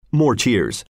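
usual speaking voice

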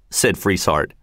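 plain reading voice

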